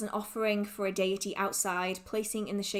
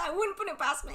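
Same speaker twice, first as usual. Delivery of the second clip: teary; 8 semitones higher, faster than usual. Real, not acted. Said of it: "I might cry".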